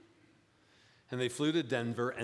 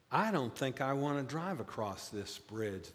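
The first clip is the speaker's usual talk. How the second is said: ordinary talk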